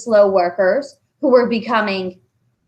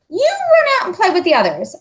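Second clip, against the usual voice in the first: hurried teacher; 11 semitones higher, 4 dB louder, faster than usual. Acted. Teacher sending him out.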